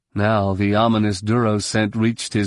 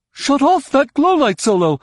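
very high, loud order; high-pitched urgent character shout; 5 dB louder than usual. A narrator's reading. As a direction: yell at a comrade mid-fight. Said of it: "Droma shouted".

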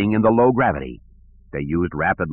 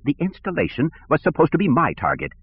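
lightly voiced petty director, higher; peevish, a touch higher; whiny bureaucrat; 7 semitones higher than usual.

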